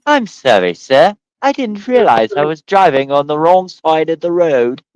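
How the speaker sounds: mock English motorist pleading innocence; English accent. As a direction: apologize in a hammed-up English accent. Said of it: "start talking with your English accent".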